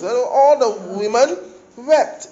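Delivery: high, loud, slow womanly wail; women's weeping voice. Shown as a demonstration. Preacher demonstrating how the women wept, high and loud. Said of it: "not the women's voice".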